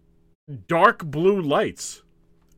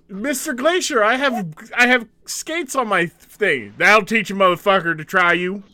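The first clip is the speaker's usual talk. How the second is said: mock coach yell; 7 semitones higher, 4 dB louder than usual. Mock coach yelling at player.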